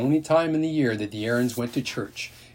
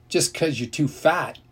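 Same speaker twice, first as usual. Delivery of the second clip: ordinary delivery, nothing marked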